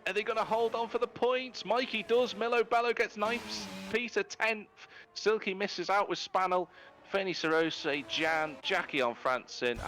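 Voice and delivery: very high, urgent results call easing down; live finish-line play-by-play. Real, not acted. Commentator calls the last points places at the line, excited play-by-play. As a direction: rattle off the finishers, high then descending.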